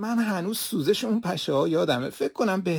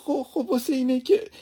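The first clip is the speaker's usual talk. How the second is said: higher, louder, caught between sob and laugh; sobbing laugh-cry; 6 semitones higher, 4 dB louder than usual. Acted. Maid stammering to the master, laughing through tears. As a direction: stammer it out half crying.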